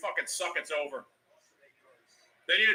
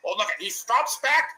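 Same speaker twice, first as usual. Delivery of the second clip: ordinary delivery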